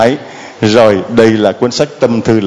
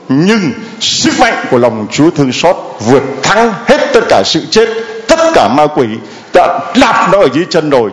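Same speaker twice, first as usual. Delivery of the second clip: preaching higher, gathering force; rising preaching; 4 semitones higher than usual. Acted. Priest proclaiming mercy's victory to the congregation, higher rising preaching. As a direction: build the triumph over evil.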